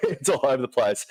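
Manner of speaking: laughing through the words; real laughter breaking into the commentary; amused laughing. Real, not acted. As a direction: chuckle helplessly as you talk. Both screens flickering, lost his place.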